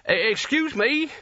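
high, slow, stammering polite voice; timid Nigel. A narrator's reading. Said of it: "he said".

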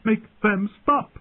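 high, frantic pleading; hysterical, high-pitched outcry; desperate crying out. A narrator's reading. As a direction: beg at the top of your range. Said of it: "she cried".

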